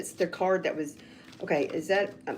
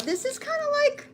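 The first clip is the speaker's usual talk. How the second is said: sudden high squeal. Real, not acted.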